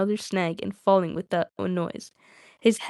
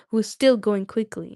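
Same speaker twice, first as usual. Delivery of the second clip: plain reading voice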